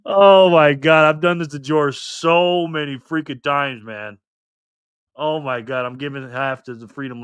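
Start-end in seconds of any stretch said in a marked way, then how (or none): none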